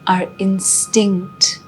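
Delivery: slow meditation guide with long pauses; guided meditation. Acted. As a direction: ease them inward, slowly and unhurriedly.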